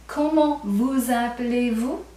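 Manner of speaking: "Comment vous appelez-vous?" said slowly, each syllable clear; slow formal demo. Shown as a demonstration. Teacher to class, slowly modelling the formal question. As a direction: spell it out slowly for learners. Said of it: "Three times I give an indication that I'm being formal".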